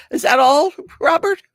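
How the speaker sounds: high, loud teasing surprise; playful disbelief at a viewer; mock surprise. Real, not acted. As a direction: pitch it way up, teasing. Teasing a chat commenter.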